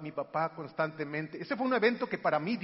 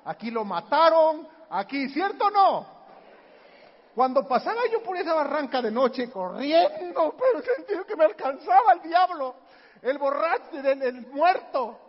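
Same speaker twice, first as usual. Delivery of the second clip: louder, higher, rushing through the legends; excited storytelling; 8 semitones higher, 10 dB louder than usual. Real, not acted. Telling of running past the ravine at night, loud and thrilled. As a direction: build the fright as you list the ghosts.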